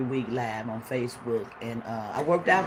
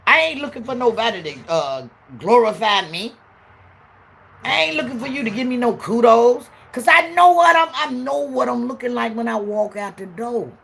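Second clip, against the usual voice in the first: loud, higher confident shout; preaching at full force; 7 semitones higher, 8 dB louder than usual. Acted. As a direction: preach it to the back row.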